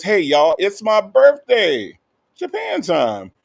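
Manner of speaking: loud, high celebratory whoop; excited birthday announcement. Acted. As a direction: stretch it out high like a party cheer. Reads out viewer's birthday announcement.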